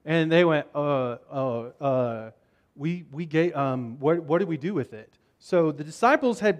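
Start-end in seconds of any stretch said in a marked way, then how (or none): 0.7-5.1 low, halting, fumbling for an answer; sheepish stalling. Acted. Disciples realizing nobody brought the bread, sheepish. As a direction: stumble through it, embarrassed.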